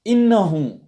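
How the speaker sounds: surprised exclamation. Shown as a demonstration. Exclaiming on spotting someone.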